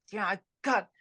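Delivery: ordinary talk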